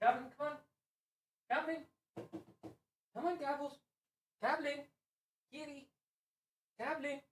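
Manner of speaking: very high, soft sing-song call; high gentle pet call; calling the cat. Real, not acted. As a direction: coax the cat to come in.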